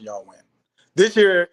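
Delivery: normal talking voice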